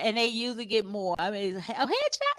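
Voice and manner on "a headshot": very high questioning a headshot; mock surprise. Client surprised by the offer, high mock surprise.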